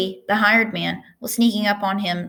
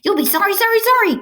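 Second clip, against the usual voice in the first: much higher, louder goose cry; shouting goose; 13 semitones higher, 5 dB louder than usual. A narrator's reading. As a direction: squawk orders like a bossy goose. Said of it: "called the goose".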